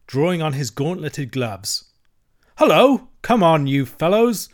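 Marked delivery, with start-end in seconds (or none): 2.6-4.5 loud, high, hearty hail; cheerful. A narrator's reading. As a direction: call out cheerily to your pals. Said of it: "he cried cheerfully".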